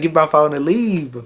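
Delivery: loud, high yell to leave; loud mock yell to go; retold yell. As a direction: bark it out, fed up. Retold demand, laughing, shouted.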